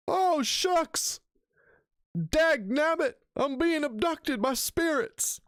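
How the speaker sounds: squeaky high, hammed-up cry of panic; mock yelling ghost hunter. Streamer imagining the hunter dragged off, squeaky mock panic. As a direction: squeal like a cartoon coward being dragged off. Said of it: "while he's yelling".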